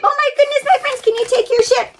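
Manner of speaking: ordinary delivery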